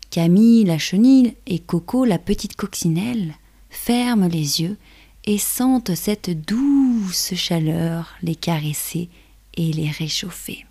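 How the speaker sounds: plain reading voice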